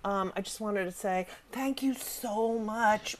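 sheepish passenger behind her quietly says thanks; timid stranger. Retold or imitated. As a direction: shyly murmur your thanks. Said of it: "the guy sitting in behind me is like".

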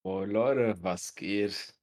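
talking through a blocked nose; congested, nose all stuffed up; stuffy nose. Real, not acted. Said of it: "du hörst dich aber ein bisschen verschnupft an".

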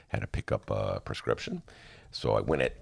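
usual speaking voice

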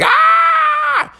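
piercing high cockatoo squawk; bird screech. Retold or imitated. Comic imitating a cockatoo licked by dogs, shrill screech. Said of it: "And it's like".

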